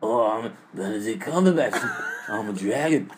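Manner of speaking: low, lightly voiced movie dragon; a hint of the Hobbit dragon; Smaug impression. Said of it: "I do the one from The Hobbit".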